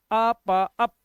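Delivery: "अप" in separate sounds a and pa, then joined; sounding out. Shown as a demonstration. Teacher repeating how the word is read, sounding out. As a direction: break "अप" apart, then blend it. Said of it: "इसे हमने ऐसे पढ़ा".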